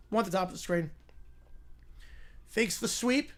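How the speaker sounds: lively TV announcer calling the drive; play-by-play call. Acted. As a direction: call the game like a network broadcaster.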